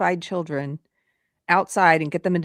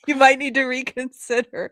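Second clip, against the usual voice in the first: laughing quote; 8 semitones higher than usual. Retold or imitated. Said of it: "You're like".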